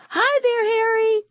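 very high singsong hello; cutesy greeting. Acted. Rima waves to Harry the fly, cutesy greeting. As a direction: pipe up sweetly to someone tiny.

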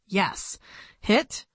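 plain reading voice

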